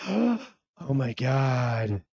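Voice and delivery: gasp, then a slow stunned oh my God; shocked gasp. Retold or imitated. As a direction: imitate a viewer seeing the price. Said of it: "I know you're looking at this going".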